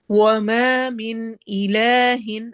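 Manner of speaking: slow chanted tajweed with a long drawn ha; Quranic recitation. Shown as a demonstration. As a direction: recite it, stretching the vowel. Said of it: "الف مدہ اور نون پہ اظہار".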